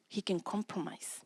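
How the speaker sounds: usual speaking voice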